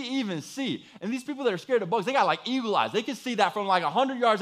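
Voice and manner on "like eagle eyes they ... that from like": ordinary delivery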